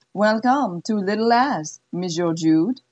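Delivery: put-on slow, cool French-accented welcome; an appraising madam savoring each word; French-Canadian accent. Zizi receiving Jude in her study, cool French accent.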